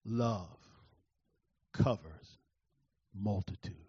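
Holy Spirit comforting the hurt speaker, tenderly; tender, low and slow. Acted. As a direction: say it like a gentle inner voice. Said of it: "the Holy Spirit brought ... He said".

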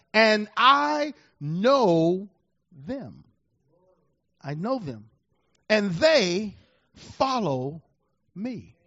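drawn out, each word held; slow solemn quoting. Acted. Preacher slowly savouring Jesus' words. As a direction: let the scripture hang slowly.

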